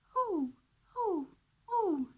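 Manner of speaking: high hooting owl call. Shown as a demonstration. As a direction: hoot like an owl for kids. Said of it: "the owl likes to say".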